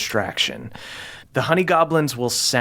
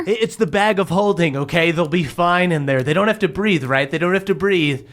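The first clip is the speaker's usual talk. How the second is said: higher-pitched, hasty-sounding self-justification; defensive protest; 5 semitones higher than usual. Acted. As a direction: play someone pleading their case after a slap. Balinor defends himself after slap.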